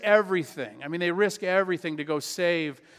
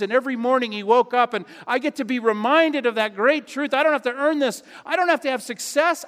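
higher and louder, rising preacher's fervor; full-force preaching; 7 semitones higher, 5 dB louder than usual. Acted. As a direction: preach it out with growing passion.

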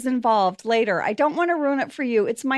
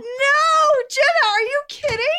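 shocked shout; 12 semitones higher than usual. Real, not acted. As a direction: cry out in disbelief. Shocked outburst.

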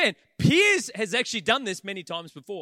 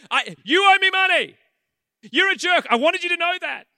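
much higher, louder mock yell; hammed-up yell at a dead man; yelling at coffin; 10 semitones higher, 10 dB louder than usual. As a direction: rant at the coffin like a wild mourner. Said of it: "starts yelling at the coffin".

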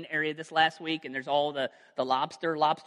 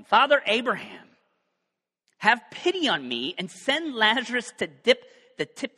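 a hint of a desperate cry, louder and higher; pleading rich man; 6 semitones higher, 6 dB louder than usual. The rich man begs Father Abraham from Hades, loud plea. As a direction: call up out of the fire, begging.

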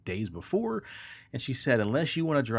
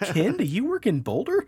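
teasing question; 12 semitones higher, 6 dB louder than usual. Retold or imitated. Teasing friend.

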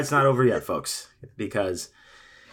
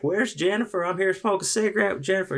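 put-on drunk woman; 12 semitones higher, faster than usual. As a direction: play the tipsy stranger barging in. Drunk woman voiced in story.

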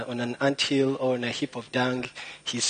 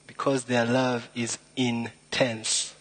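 slow punchline; slower than usual. Acted. Punchline delivered slowly.

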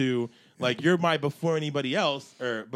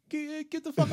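meek, high-pitched squeak; timid falsetto yelling; squeaky high voice; 12 semitones higher, 3 dB quieter than usual. Retold or imitated. Mocking a timid lawn owner.

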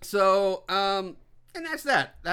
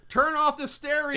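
mock shout; 9 semitones higher, 4 dB louder than usual. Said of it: "He's like".

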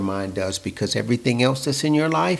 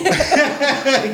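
high laugh; 3 dB louder than usual. Real, not acted. Laughing at the joke.

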